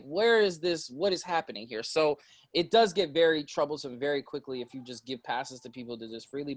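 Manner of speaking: ordinary talk, nothing marked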